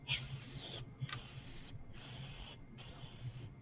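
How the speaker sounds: soft breathless hissing snake; voiceless snake hisses. Shown as a demonstration. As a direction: hiss like a snake. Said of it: "Time to be snakes".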